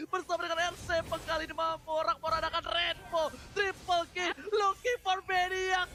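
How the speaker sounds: high, hyped play-by-play call; fighting game announcer. Acted. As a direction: build the call up, rising excitement. Live esports play-by-play hype.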